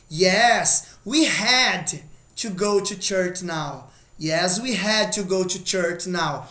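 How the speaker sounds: slow model answer. Shown as a demonstration. Teacher modelling the affirmative slowly. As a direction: read the answer slowly and low. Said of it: "Olha a afirmativa".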